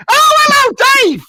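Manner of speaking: high, loud medium hello; psychic greeting. Acted. Medium hailing Dave from the stage, high and loud. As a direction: call the name out like a stage psychic.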